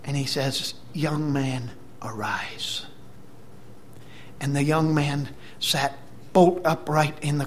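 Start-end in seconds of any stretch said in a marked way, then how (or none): 0.9-2.9 soft summons sinking low on the last word; hushed retold command; gentle command. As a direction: quietly tell the dead to rise. Jesus raises the widow's son.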